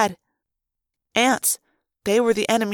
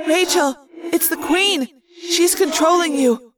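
higher, urgent thought-voice; urgent warning; 5 semitones higher than usual. A narrator's reading. Narrator warns Rachel the Queen controls her, urgently. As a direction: warn a friend with alarm. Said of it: "I said".

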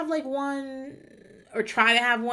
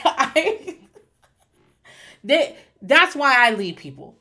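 usual speaking voice

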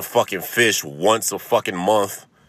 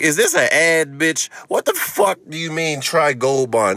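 higher, louder indignant retort; mock outrage; 9 semitones higher, 4 dB louder than usual. Comic retelling his outraged reply to his doctor, higher and louder. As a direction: fire back in disbelief at the doctor. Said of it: "I'm like".

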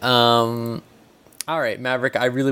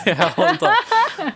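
laughing; much slower than usual. Real, not acted. Laughing while speaking.

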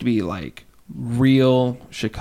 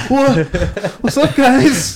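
mock intruder voice; 13 semitones higher, 7 dB louder, much slower than usual. Kids caught playing, mocking intruder.

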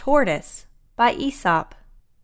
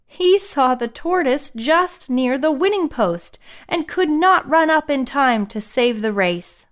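ordinary delivery, nothing marked

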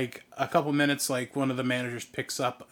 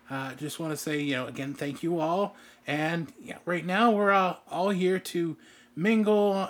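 manager addressing guests, pitch lifted at the start; polite meet-and-greet announcer; event manager's welcome; 4 semitones higher than usual. Acted. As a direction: play a host welcoming a VIP crowd. Voices management greeting VIP guests.

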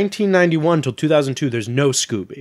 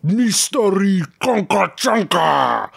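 slow alien gibberish voice; Jabba the Hutt voice; 5 semitones higher, slower than usual. Shown as a demonstration. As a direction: turn the title into Huttese. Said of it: "it's sort of a".

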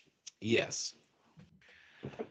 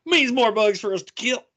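gung-ho soldier; 9 semitones higher, 5 dB louder than usual. Retold or imitated. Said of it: "Just go".

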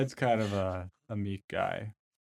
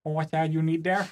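meek helpful guy; 6 semitones higher than usual. Acted. GM voices meek Ted.

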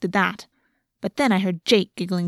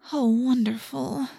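moan; 3 semitones higher, 4 dB quieter, slower than usual. A narrator's reading. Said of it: "Cassie moaned".

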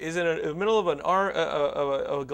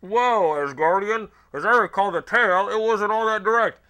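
loud, raised superhero voice; Iron Man; 3 semitones higher, 6 dB louder than usual. Acted. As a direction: declaim it like an armored comic-book hero. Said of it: "that's Iron Man, so he's gotta talk like that".

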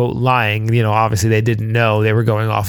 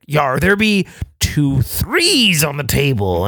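pirate voice; 2 semitones higher than usual. Retold or imitated. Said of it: "James could be like".

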